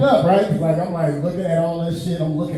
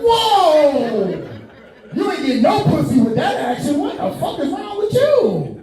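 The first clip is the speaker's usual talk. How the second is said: incredulous whoa; 7 semitones higher, slower than usual. Retold or imitated. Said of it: "I'm like".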